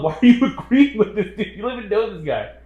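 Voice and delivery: high, loud mock outrage; indignant comeback, played for laughs; incredulous retelling. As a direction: challenge the commenter in laughing disbelief. Retold reply to online commenter.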